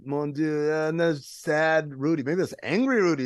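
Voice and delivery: usual speaking voice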